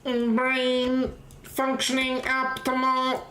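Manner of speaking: high, slow robot voice. Pretend. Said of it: "Why does my brain sound like a robot".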